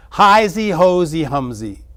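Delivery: high, loud mocking singsong; mocking ridicule. Mother mocking father's baby talk, loud and high. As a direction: mimic him to ridicule him. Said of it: "she had to ridicule him".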